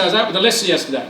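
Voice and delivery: ordinary delivery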